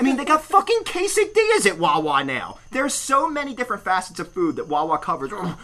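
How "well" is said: loud, slow, jowly mimicry; mock him with a drawn-out blubbery demand; hammed-up slobbering bluster; rude customer. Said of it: "he looks up and goes".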